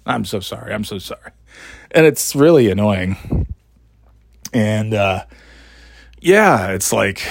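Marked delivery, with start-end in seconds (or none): 0.1-1.3 scratchy apology. Retold or imitated. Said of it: "scratchy sort of throat thing".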